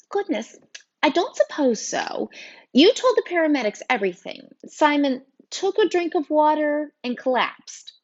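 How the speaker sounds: high-pitched, fretful woman teacher; flustered teacher. A narrator's reading. Teacher recounting a student's collapse, flustered. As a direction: fret in a high voice.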